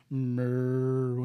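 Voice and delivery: low, calm cow moo. Retold or imitated. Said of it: "a regular cow".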